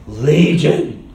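loud, hammed-up demon answer. Evil spirit giving its name to Jesus, demon voice. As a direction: announce it as a booming evil spirit. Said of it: "I wonder if he talked like that".

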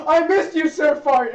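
high, loud excited shout; hammed-up joyful greeting; overjoyed reunion. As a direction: gush loudly at a long-missed friend. Acted reunion with returning knight.

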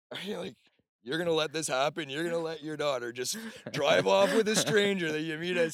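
loud, high-pitched incredulous protest; mock disbelief. Speaker to the girl's mom about leaving with a stranger, mock disbelief. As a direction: challenge her as if scandalized, voice shooting up.

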